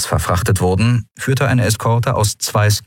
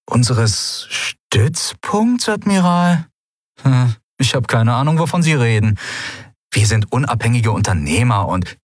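pirate pretending not to understand; Sephiron, feigned innocence; 5 semitones higher than usual. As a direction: play dumb theatrically.

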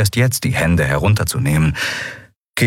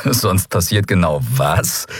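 slow, mildly amused challenge; slower than usual. A narrator's reading. Gunman answering his captive's warning, amused. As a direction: ask it slowly, toying with the threat. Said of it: "fragte er amüsiert".

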